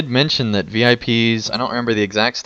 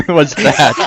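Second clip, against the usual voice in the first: laughing; 8 semitones higher, 5 dB louder than usual. Retold or imitated. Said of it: "Lily Kate said".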